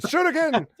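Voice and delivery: high, loud attack shout; hammed-up combat holler; mock anime battle cry. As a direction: yell it like a cartoon fighter. Mimics anime character's attack cry.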